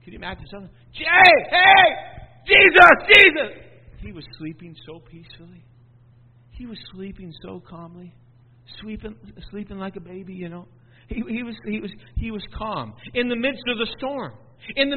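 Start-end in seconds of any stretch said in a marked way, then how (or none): 0.9-3.5 high, loud calling to wake him; urgent shout. Acted. Disciples waking Jesus in storm. 4.0-10.7 much softer, calm description; soft peaceful voice. Acted. Contrast: peaceful sleep.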